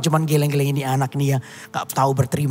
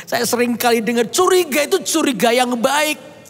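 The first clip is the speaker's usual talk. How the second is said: raised, fervent preacher's voice; preaching; 8 semitones higher than usual. Acted. Preacher urging the congregation to trust God, fervent. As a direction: drive the lesson home to the congregation. Preacher drives the lesson home.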